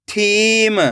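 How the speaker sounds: थीम joined as one word with long ई; blended word. Shown as a demonstration. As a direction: read थीम whole, stretching the थी. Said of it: "खींच के पढ़ना है".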